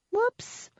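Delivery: high, girlish mock-innocent oops; giggly. A narrator's reading. Woman dropping her card on purpose, giggly. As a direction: squeak it high in fake surprise. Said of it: "I giggled".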